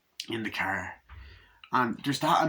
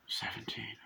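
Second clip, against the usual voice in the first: stunned whisper; 13 dB quieter than usual. Retold or imitated. Repeating the drunk boy's age back, in a stunned whisper. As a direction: drop to a very soft, voiceless whisper.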